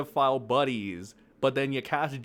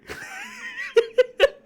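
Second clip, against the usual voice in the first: high shrieking laugh; manic laugh; 6 dB louder than usual. Real, not acted. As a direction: cackle wildly. Laugh after threat.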